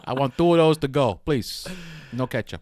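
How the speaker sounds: loud, hammed-up Italian-American accent; put-on New Jersey Italian accent; mock Jersey Italian. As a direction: order like a brash Jersey Italian. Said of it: "that's New Jersey Italian".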